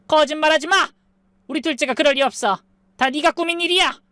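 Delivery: high, indignant denial; angry mother-in-law. A narrator's reading. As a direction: accuse back, voice pitched high. Mother-in-law rejects the accusation.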